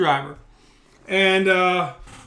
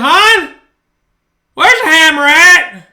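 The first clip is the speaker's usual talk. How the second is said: loud call across the house to his wife; 7 semitones higher, 11 dB louder, slower than usual. Retold or imitated. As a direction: shout for her from another room.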